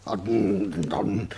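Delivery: usual speaking voice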